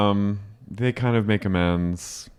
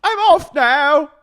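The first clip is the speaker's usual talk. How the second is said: very high, loud, slow British farewell; hammed-up British squeal; mock British; 6 dB louder, slower than usual. Co-host echoing the exit, squealed mock British accent. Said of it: "goes back into a British accent".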